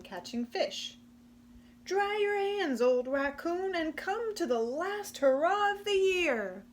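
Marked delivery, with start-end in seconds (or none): 1.8-6.6 higher, louder coaxing; eager invitation. Acted. Possum urging Raccoon to the party, high and eager. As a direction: talk Raccoon into coming.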